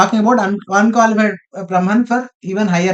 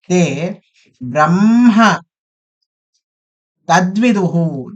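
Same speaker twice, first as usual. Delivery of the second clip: slow, drawn-out chanting; Sanskrit recitation; much slower than usual. Acted. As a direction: intone each word slowly. Recites the verse phrase by phrase.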